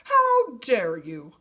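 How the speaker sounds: slow, loud outraged demand; indignant anger. A narrator's reading. Giraffe kicking at the vine, slow outraged anger. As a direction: spit out each word, offended. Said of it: "with an angry kick".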